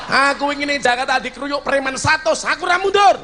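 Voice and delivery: high-pitched, swaggering boast; acted bravado. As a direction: boast loudly as if facing down a gang. Acts out boast about facing thugs.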